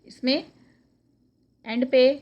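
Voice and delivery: ordinary delivery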